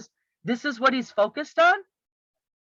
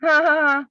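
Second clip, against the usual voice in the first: loud, high sarcastic laugh; 7 semitones higher, 6 dB louder than usual. Acted. Critic scoffing at the candidate's promise to represent, sarcastic laugh. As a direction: burst out with a mocking, put-on laugh. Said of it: "yeah, I'm being sarcastic".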